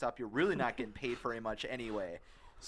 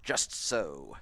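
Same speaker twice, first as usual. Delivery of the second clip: put-on old rich guy voice; 9 semitones lower than usual. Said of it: "that's old rich guy sound".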